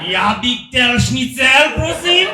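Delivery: loud, hammed-up German accent. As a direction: bark the order like a German. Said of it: "sound like a German".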